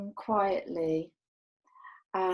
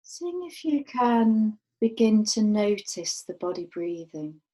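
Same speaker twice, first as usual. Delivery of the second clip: calm, unhurried meditation voice with long pauses; guided meditation. Real, not acted. Teacher guiding a group to rest awareness on the breath, guided meditation. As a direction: guide them gently, letting each phrase settle and fall away.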